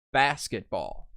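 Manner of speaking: basketball with BAS hit hardest; stress on first syllable. Shown as a demonstration. Teacher models the word for students, stress on BAS in basketball. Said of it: "accent mark over the first syllable".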